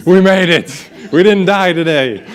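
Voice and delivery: relieved parent. Retold or imitated. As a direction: celebrate surviving the day, half laughing. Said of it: "when I put the kids to bed at night".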